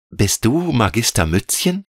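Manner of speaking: put-on high little girl's voice, pleading; a small child afraid to be left. A narrator's reading. Veronika begs the air spirit to stay, high child voice. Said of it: "fragte sie".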